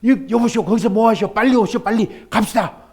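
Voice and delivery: loud, high shout from the boat; calling out. Acted. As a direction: call him over urgently. Neighbor calls from rowboat.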